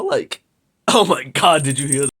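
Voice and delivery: dry, scratchy voice from being sick; hoarse and a little under the weather; parched voice. Real, not acted. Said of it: "my voice is so parched".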